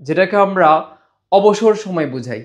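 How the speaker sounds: ordinary talk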